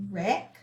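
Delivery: slow clear wreck with silent W; model word to repeat. Shown as a demonstration. Teacher models "Wreck" for students to repeat, slow and clear. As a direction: say "Wreck" as rek, W silent, for class to echo. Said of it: "So repeat after me".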